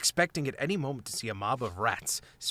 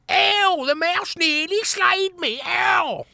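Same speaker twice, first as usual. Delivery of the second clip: high mock whining wail; imitating whining; 7 dB louder, much slower than usual. A narrator's reading. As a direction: ham up the rat's crying. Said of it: "his impression of the whining vermin".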